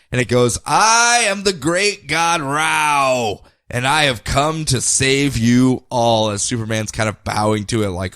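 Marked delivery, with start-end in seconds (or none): 0.7-6.3 very slow, grand mock deity; booming god voice. The god Rao addresses the planet, slow and grand. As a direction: intone it like a giant space god.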